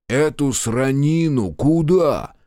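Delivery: slow, drawn-out disbelieving question; incredulous slow drawl; astonished. A narrator's reading. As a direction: stretch it out in amazement. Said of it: "изумился Пашка Капрал".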